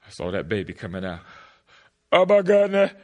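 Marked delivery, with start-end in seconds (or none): 2.1-2.9 loud, high amazed cry; amazed shock. Retold or imitated. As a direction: gasp it out in wonder. Amazed at the birth.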